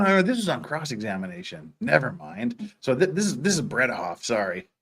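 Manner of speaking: normal talking voice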